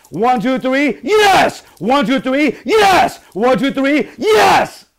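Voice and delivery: high, shouted count bursting into a piercing YES; nice and loud. Shown as a demonstration. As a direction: whip the group up with yelled cheers. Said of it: "Give it to me nice and loud".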